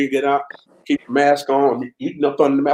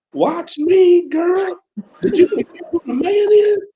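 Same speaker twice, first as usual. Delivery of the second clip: much higher, playful swagger; cocky mock brag; slower than usual. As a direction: show off to your wife in a high teasing voice. Retold brag to wife.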